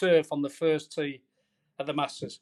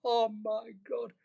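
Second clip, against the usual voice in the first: retold dismay; 7 semitones higher, 6 dB quieter, slower than usual. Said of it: "I'm like".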